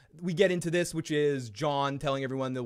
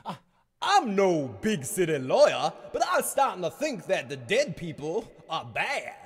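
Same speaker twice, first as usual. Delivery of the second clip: mock folksy lawyer; 4 semitones higher than usual.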